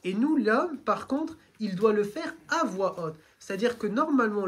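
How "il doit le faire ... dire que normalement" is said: usual speaking voice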